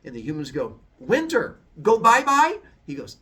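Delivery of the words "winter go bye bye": put-on high, loud baby talk; babyish explanation. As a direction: talk to him like a toddler. Humans answer Zeus.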